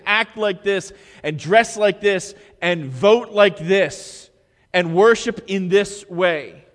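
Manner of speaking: loud, rising sermon voice; preaching at full force. Acted. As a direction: drive it home to the congregation.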